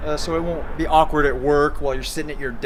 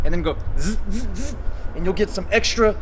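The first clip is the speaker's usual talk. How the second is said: higher, louder buzzing zzz; buzzing z sound; 6 semitones higher, 5 dB louder than usual. Shown as a demonstration. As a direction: buzz a z to squeeze the abs. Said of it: "by making a z sound".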